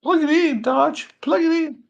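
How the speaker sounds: very high, loud squeaky put-on voice; high mock nagging. Host ordering himself to plug in, in mock-nagging falsetto. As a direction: nag in a piercing comic falsetto.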